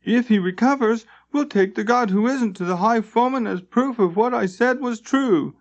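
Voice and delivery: a zealous plan spoken high; fervent plan to expose the lie; Limbeck's voice. A narrator's reading.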